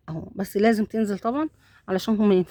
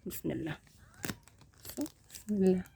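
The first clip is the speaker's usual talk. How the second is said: murmured blessing; 10 dB quieter than usual. Real, not acted. Blessing the kittens under her breath.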